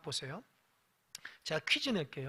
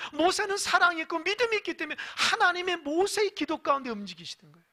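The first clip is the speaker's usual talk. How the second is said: higher, louder preaching push; full-force preaching; 8 semitones higher, 5 dB louder than usual. Real, not acted. Pastor pressing the congregation on answered prayer, loud preaching. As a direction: drive the point home to the congregation.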